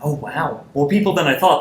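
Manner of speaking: ordinary talk, nothing marked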